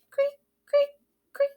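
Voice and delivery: high squeaky creak; cricket chirp. Acted. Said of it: "The crickets go".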